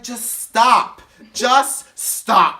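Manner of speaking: high, loud mock order; imitating a drunk woman laying down the law; emphatic command. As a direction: halt them with separate, forceful bursts. Donette cuts the others off.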